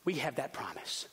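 ordinary talk, nothing marked

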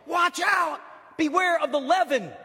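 high, loud cry of warning; shouted warning. Acted. Jesus shouts a warning to his disciples, loud high cry. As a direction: shout it out to warn them.

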